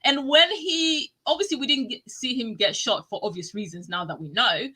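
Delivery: usual speaking voice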